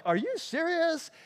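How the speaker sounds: imitating disciples' outraged disbelief; mock disbelief. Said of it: "and they're like".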